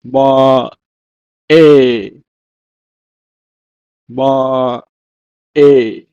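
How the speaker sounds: "ma-e" slowly, with a gap between syllables; teacher modelling "ma-e" syllable by syllable; Hebrew pronunciation demo. Shown as a demonstration. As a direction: say "ma-e" as two drawn-out, separate syllables. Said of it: "Hebrew, is pronounced as".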